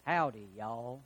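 put-on low, soft Southern "Howdy, y'all" as a joke accent; mock Southern drawl. Peter giving away his accent, in a hammed-up Southern drawl. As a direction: drop into a lazy Southern accent for the punchline. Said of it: "by your Galilean accent".

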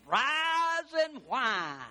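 slow, stretched whiny rise and whine; mock whine. Shown as a demonstration. Preacher mocking negative people with the pun, slow whine. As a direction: draw it out like a whiner. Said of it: "whiny pun".

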